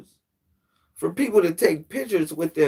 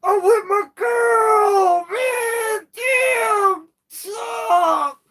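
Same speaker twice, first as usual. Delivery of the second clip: very high, slow, cracking plea; hammed-up plea from a man walking with his girl; mock desperate plea; much slower than usual. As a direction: wail it like a man begging to be left alone. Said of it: "You like".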